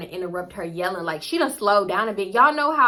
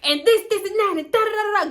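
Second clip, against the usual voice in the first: high, louder nonsense rant; mock yelling; 11 semitones higher, 5 dB louder than usual. Mom goes right back to yelling at daughter, mock tirade. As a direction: rattle off a mother's tirade in filler syllables. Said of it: "go right back to yelling".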